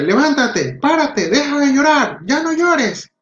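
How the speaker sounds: high-pitched exasperated scolding, rising as it goes; a fed-up parent ordering the child up. Acted. Parent scolding a child throwing a tantrum, high exasperated scolding.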